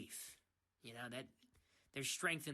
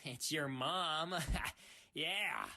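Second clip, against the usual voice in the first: loud laughing punchline, a bit higher; gloating laugh; 5 semitones higher, 5 dB louder, much slower than usual. Acted. Teasing the listener with a your-mom joke, loud gloating laugh.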